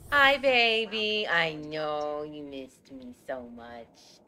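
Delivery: slow, stretched-out cooing. Real, not acted. Streamer greeting baby who missed her, doting coo. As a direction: let it sink low and linger on each word.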